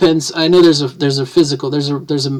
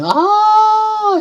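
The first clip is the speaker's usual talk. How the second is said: a sliding siren sweep up high and loud; siren glide; 5 dB louder than usual. Shown as a demonstration. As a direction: slide up like an ambulance siren. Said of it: "and, and sirens".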